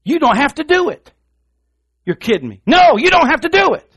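fervent exhortation. Acted. Preacher exhorting the congregation, high and loud. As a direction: cry it out like a preacher at full pitch.

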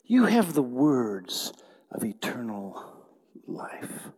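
breathy whispered you have the words of eternal life; hushed whisper. Acted. Pastor whispering to the congregation in awe. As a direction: drop to an awed whisper.

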